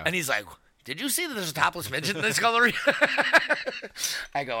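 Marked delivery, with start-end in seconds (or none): 0.8-2.7 quick, high incredulous question; mock amazed fast voice. Nate arriving.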